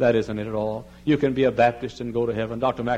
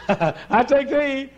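very slow, high, drawn-out vow; swooning bride; 14 semitones higher, 5 dB louder, much slower than usual. Retold or imitated. Bride drawing out her vow to the groom, high and slow. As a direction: stretch the vow out lovingly. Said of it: "and I said".

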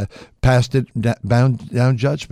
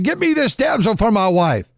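pleading son; 10 semitones higher than usual. Retold or imitated.

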